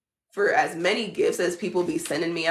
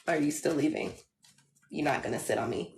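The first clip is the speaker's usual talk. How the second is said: ordinary talk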